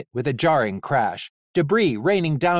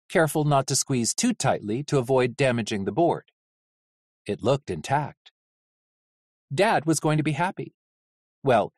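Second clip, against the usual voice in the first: plain reading voice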